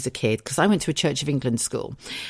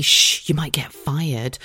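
slow mock hush; much slower than usual. Mock hushing voice.

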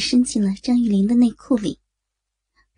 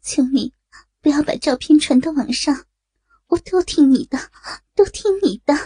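higher, distressed begging; anguished, pitched-up submission; humiliated pleading; 4 semitones higher, 3 dB louder than usual. Acted. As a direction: give in and beg, growing more urgent. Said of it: "曲小曼屈辱地说".

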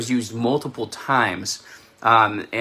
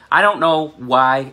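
ordinary talk

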